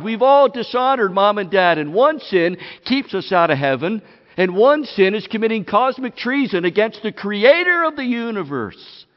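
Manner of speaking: loud, pounding preacher's voice; fiery sermon build; full-force preaching. Acted. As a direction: hammer each line home to the room.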